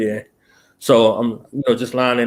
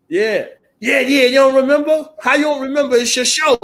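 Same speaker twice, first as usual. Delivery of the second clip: higher, louder disbelieving fan; mock incredulous fan; 11 semitones higher, 4 dB louder than usual. Host mimics fan scoffing that he forgot his own show, incredulous. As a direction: scoff like a fan who can't believe it. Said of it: "That's how they talk to you".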